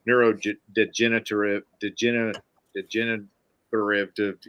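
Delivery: tongue-tied tries at "degenerative", breaking off midway; real struggle to get "degenerative" out; stumbling over the word. Real, not acted. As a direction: trip over the syllables of "degenerative". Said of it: "I just can't say that word".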